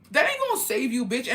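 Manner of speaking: high-pitched retold comeback; mock angry retort. Woman snubbing the drunk girl's friend, mock angry retort.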